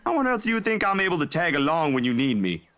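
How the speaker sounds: high-pitched, exasperated comeback; indignant, pitched well up; indignant protest. Acted. Bickering back at teasing friend.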